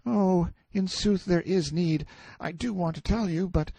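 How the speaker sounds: high, frightened hesitation; trembling boy. A narrator's reading. Boy torn between desire and fear, frightened. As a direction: want to tell but shrink back, high-pitched. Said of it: "Why do you tremble so?".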